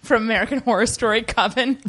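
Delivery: ordinary delivery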